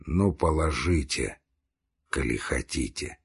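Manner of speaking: low, soft, resigned consent; weak dying voice. A narrator's reading. Dying man lets them lay him down, weak and low. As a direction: give in faintly, voice sinking.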